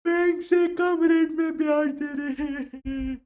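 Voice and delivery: high, slow crying plea; mock sobbing. Punjabi sobbing that friends beat the bank rate. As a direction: draw it out weeping.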